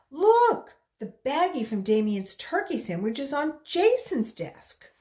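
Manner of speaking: high, loud tattling call; girl loudly tattling to the teacher. Acted. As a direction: call it out to get the teacher's attention. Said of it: "Joy called".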